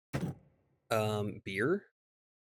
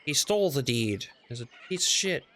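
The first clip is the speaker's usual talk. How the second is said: higher, indignant villager protest; mock the outraged villagers objecting; angry villagers; 8 semitones higher, 3 dB louder than usual. Gamer voicing villagers who dispute the deed, indignant protest. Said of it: "the villagers are gonna be like".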